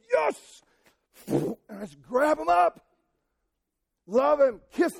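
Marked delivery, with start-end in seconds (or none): 0.1-0.6 much higher, joyful burst of yes; delighted yes. Retold or imitated. Father greeting his child's call, overjoyed exclamation. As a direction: explode with delight at your kid. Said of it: "I'm like".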